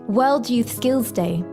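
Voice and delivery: usual speaking voice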